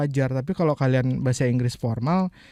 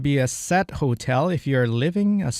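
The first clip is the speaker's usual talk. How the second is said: slow repeat; slower than usual. Shown as a demonstration. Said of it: "Gonna itu bahasa percakapan".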